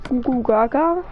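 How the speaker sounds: goofy mock baby gurgle; baby babble. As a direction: coo it like a toddler playing. Baby babble while gaming.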